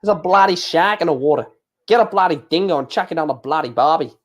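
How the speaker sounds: put-on jokey, overdone Aussie accent; mock broad Aussie twang; Australian accent. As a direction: lay the Australian accent on thick. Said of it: "sick to death of my accents".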